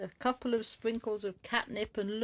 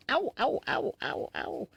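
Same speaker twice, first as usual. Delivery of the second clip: pained ow; 4 semitones higher, 4 dB louder than usual. Real, not acted. Cat bites the owner.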